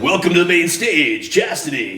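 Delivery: stripper club voice. Retold or imitated. Showing the announcing voice his girlfriend teases, club emcee style. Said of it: "my stripper club voice".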